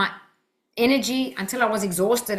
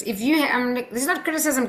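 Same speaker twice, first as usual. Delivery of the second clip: usual speaking voice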